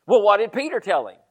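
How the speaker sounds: usual speaking voice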